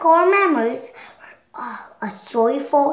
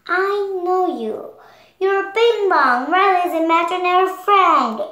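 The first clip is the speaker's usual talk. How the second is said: delighted recognition; 6 semitones higher, 4 dB louder than usual. Acted. Joy recognising Bing Bong after years, delighted. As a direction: marvel at an old friend. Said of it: "someone Joy hadn't seen in years".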